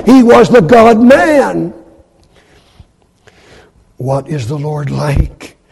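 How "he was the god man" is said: emphatic proclamation. Real, not acted. Preacher's climactic declaration.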